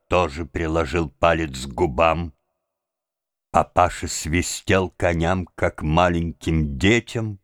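plain reading voice

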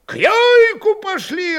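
high, loud rallying shout; calling from crowd. A narrator's reading. Voices in the crowd urge a march, calling out. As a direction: pitch the call way up over the noise. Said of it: "раздавались голоса".

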